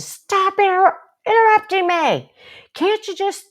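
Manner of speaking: shrill angry outburst. Shown as a demonstration. Counselor showing the unkind way, high and loud. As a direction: blow up in frustration. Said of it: "instead of".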